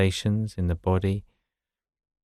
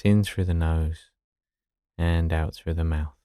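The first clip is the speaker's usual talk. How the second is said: guided meditation. Acted.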